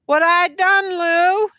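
high, loud lament; anguished question. A narrator's reading. Weeping woman asks her friend.

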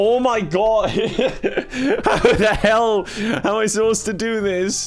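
high, exasperated, laughing through the words; helpless laughing despair. Real, not acted. Gamer finds the task impossible, laughing despair. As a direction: crack up in disbelief. Robot dead, player cracking up.

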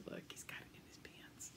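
a hushed, secretive whisper; whispered aside. Real, not acted. Reader tells the children a secret, whispering.

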